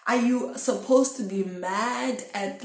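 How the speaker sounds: ordinary talk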